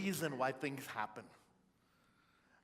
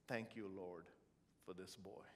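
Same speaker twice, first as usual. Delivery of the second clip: low, soft retelling of a dying man's prayer; soft, low and reverent, fading at the end; hushed dying words; 6 semitones lower, 10 dB quieter than usual. Retold or imitated. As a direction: lower the voice right down, barely voicing a last blessing. Dying mentor's last words, retold.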